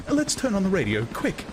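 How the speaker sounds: high, hurried woman's plea; a frightened woman wants noise; nervous urgency. A narrator's reading. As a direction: grab for comfort, quickly. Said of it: "reached for the dialing knob".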